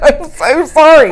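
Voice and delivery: high, drawn-out wailed sorry; loud mock apology. Wife retelling her apology to her husband, loud mock wail. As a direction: wail an exaggerated apology, half laughing.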